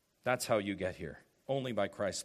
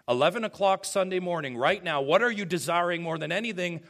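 preaching at full force; 5 semitones higher, 7 dB louder, faster than usual. Real, not acted. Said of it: "preaching at full force".

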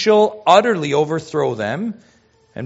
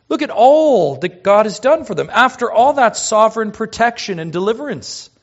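normal talking voice

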